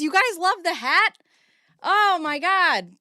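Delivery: excited. Real, not acted. Delighted at hat compliments.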